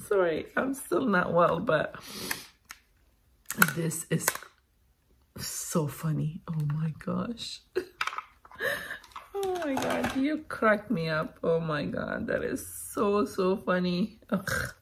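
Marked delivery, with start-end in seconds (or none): none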